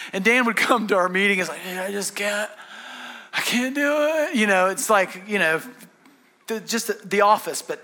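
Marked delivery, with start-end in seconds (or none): 1.6-4.4 hammed-up defeated whine, drawn out and high; stretched-out, high-pitched mock giving up; mock despair. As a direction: playfully imitate a worn-down worker at wit's end. Acting out coworker's despairing complaint.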